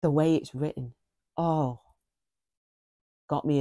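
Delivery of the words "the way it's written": normal talking voice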